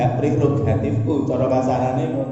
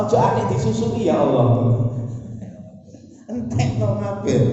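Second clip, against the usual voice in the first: Noah's prayer; 7 semitones higher than usual. Acted. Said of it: "Dongane ngoten lho".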